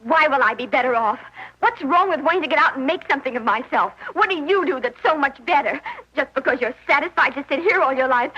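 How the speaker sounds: normal talking voice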